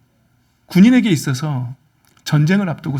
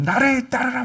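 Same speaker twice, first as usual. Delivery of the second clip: commanding general; 5 semitones higher, 6 dB quieter than usual. Acted. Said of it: "막 앞에서 장군이".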